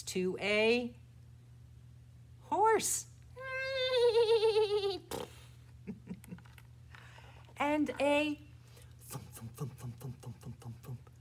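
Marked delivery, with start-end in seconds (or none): 3.1-7.5 high, fluttering neigh; whinny out long and high; horse whinny. Pretend. Teacher being the horse for children, whinnying. Horse noise after naming horse. 9.1-11.1 low, soft, even thuds; thumping. Pretend. Teacher imitating the puppy's tail, soft low thumping.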